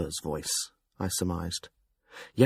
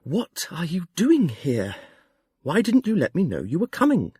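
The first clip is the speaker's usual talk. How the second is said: near despair; 9 semitones higher than usual. Acted. The fool surprised by an old friend, despairing. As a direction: caught off guard and close to despair. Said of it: "It was almost despair".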